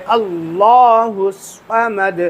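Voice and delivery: slow chanted اللہ الصمد, heavy لام; heavy lam. Shown as a demonstration. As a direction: recite اللہ الصمد slowly with a full لام. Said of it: "اس کو لام کو پر کرنا ہے".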